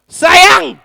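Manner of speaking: high, loud call across the house. Acted. Husband yells for his wife, a loud high call. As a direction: bellow for her from the table.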